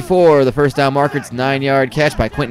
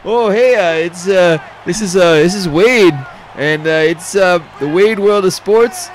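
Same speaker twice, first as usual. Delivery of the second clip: put-on a bumbling Canadian host named Wade, Canadian accent; cheesy Canadian accent; 3 semitones higher, 4 dB louder than usual. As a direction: hem and haw like a folksy Canadian announcer, Canadian accent. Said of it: "just us doing shitty Canadian accents".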